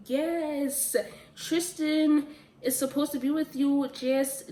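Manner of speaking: root for the couple out loud; excited cheering. Retold or imitated. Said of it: "I'm like".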